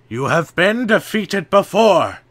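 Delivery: loud, high defiant shout. A narrator's reading. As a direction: defy him at full voice. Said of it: "shouted Vakama".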